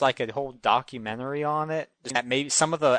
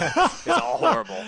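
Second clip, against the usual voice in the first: laughing echo; 9 semitones higher than usual. Real, not acted.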